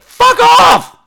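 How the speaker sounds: high, loud comic shout; exasperated yell. Retold or imitated. Viewer fed up with the cast on camera, exasperated yell. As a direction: explode at the screen.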